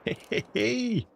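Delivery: high, whooping cheer of triumph; excited cheer. Real, not acted. Celebrating a legendary animal.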